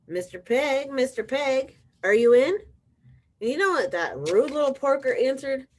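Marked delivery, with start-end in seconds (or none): 0.1-2.6 calling out. Acted. Wolf knocks, calls pig.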